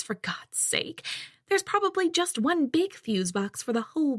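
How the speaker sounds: lightly voiced haughty mother. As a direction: talk down like a snobbish mother.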